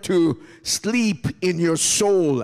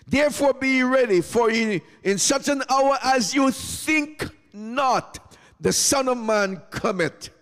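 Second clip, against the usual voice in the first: high, sustained preaching voice; impassioned preaching; 6 semitones higher than usual. Real, not acted. Preacher urging congregation to get ready, impassioned preaching. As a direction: preach it high, dropping at phrase ends. Preacher lifts into full exhortation.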